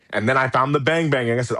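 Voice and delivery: ordinary talk, nothing marked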